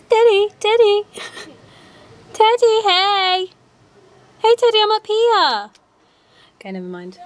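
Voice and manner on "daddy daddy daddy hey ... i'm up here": put-on high, loud, childish calling for Daddy; mock the kids yelling for dad; imitating little sisters. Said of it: "All I hear is".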